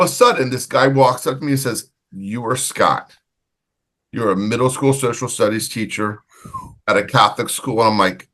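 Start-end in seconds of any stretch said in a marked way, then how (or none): 2.1-7.8 imitated stranger's recognition. Quotes stranger recognising him at bar.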